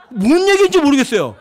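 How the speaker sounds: can't believe it, laughing; amused exasperation. Real, not acted. Laughing at absurd excuse.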